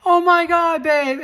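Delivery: squealing high and loud with joy; overjoyed high cry. Retold or imitated. Hairdresser hearing the news, squealing with joy. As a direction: burst out in delighted shock.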